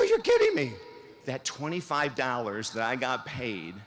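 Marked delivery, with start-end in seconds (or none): 0.0-0.7 shoots way up high and loud in disbelief; can't believe the offer he just got; stunned disbelief. Retold or imitated. Speaker reenacting his reaction to a radio show offer, shocked high exclamation.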